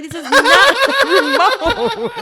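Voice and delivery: high, loud words broken by laughter; laughing while scolding. Retold or imitated. Mom telling son it's no remote, laughing. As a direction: try to stay stern but crack up.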